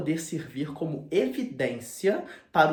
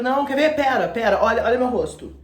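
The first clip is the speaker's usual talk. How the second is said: frantic pleading; 8 semitones higher, 9 dB louder than usual. Retold or imitated. Dorian pleading with accuser.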